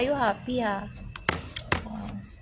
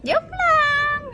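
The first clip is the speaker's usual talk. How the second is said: squealed joke; 7 dB louder than usual. Real, not acted. Laughing off a crude joke.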